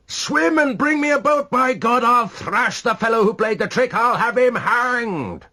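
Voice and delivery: high, loud, raging threats, slowing at the end; furious yelling. A narrator's reading. Rashleigh threatening the trickster, furious yell. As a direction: rage at whoever played the trick. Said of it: "yelled Rashleigh".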